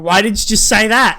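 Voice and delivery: high, loud, exasperated retort; mock exasperation. Baffled listener to man using slang, mock exasperated. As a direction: burst out in comic frustration.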